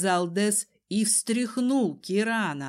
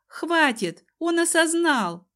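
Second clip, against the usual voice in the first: high, raised, insistent call; loud shout; 8 semitones higher, 5 dB louder than usual. A narrator's reading. Heroine calls off Desmond's punishment, shouting. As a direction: shout up to stop him. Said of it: "громко крикнула я".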